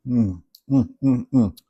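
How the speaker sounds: appreciative mm sounds while tasting; tasting off spoon. Real, not acted. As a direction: savour it, humming mm with each taste. Tasting the finished sauce.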